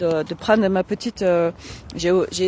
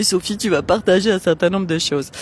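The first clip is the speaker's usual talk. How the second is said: self-mocking; 3 semitones lower than usual. Shown as a demonstration. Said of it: "Sans déconner".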